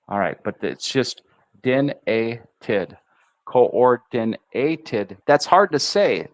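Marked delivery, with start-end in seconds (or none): 3.5-5.2 Coordinated drawn out slowly, N pushed twice; slow syllable drill. Shown as a demonstration. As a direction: break Coordinated into its beats. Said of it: "so we push with the N twice".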